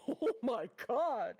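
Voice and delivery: cracking up in high, loud disbelief; shocked laughter. Real, not acted. Reacting to official birthday art on stream, shocked and laughing. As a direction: laugh through a stretched, scandalized reaction.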